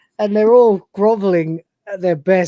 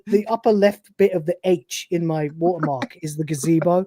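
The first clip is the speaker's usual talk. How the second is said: ordinary talk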